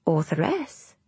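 softly voiced, rising question; a hint of a puzzled child; puzzled little girl. A narrator's reading. Little girl queries the boy's strange word, mildly puzzled.

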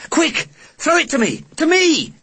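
high urgent shout. Acted. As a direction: yell it across the room. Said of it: "Kirsty call out".

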